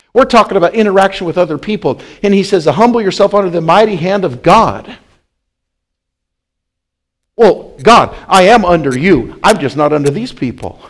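"Humble yourself" cried out loud and high; loud preacher's proclamation; preached verse. Acted. Preacher argues at full volume.

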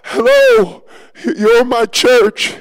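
excited calls to the men in the field; calling out, then settling. Retold or imitated. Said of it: "I screamed again".

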